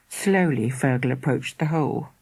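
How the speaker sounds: usual speaking voice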